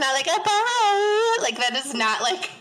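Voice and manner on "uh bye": high, drawn-out sorority-house bye; mock sorority girl sign-off, pitched way up; aggressive bye. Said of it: "Oh, it's so aggressive".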